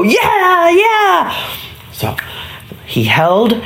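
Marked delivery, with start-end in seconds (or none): 0.0-1.5 put-on high, drawn-out excited boyish cheer; cheering boys. Boys answer the super, ready to whack the piñata, excited high cheer. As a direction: cheer like a crowd of eager boys. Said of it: "all the boys go".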